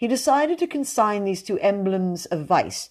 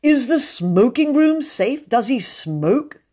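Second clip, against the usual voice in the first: raised, aggrieved moan; moaning complaint; 6 semitones higher, 5 dB louder than usual. A narrator's reading. Mr Brown moans to William about the guest, raised and aggrieved. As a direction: whine about being hunted in your own house. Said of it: "he moaned".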